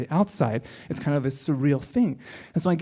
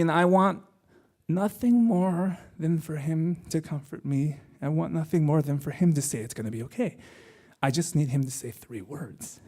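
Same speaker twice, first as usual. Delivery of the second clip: usual speaking voice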